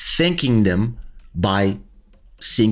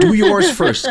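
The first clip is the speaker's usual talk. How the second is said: playful challenge; 4 dB louder than usual. Real, not acted. Teasing challenge to friend.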